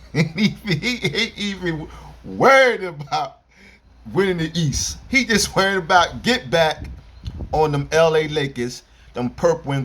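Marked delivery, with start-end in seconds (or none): 0.0-5.0 laughing through the words, slower; chuckling while telling it. Real, not acted. Laughs at his own joke.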